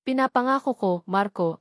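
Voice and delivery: usual speaking voice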